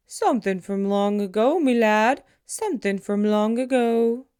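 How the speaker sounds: put-on loud, hearty old man; old man's voice. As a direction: boom it out like a jolly old man. Old man answering child in story.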